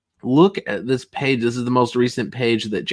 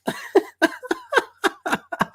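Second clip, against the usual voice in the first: much higher squeaky mock laugh; imitated Mike laugh; 4 dB quieter than usual. Streamer imitating Mike's laugh for the chat, high giggle. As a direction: cackle like your friend Mike does. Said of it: "[name] does the best Mike laugh".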